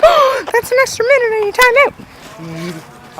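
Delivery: high, slow mock-scandalized mother; gasp, then scold in a high drawn-out voice; shocked mom. Mom adds a minute for swearing, high shocked voice.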